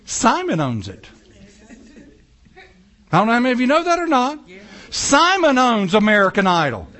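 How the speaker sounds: ordinary talk, nothing marked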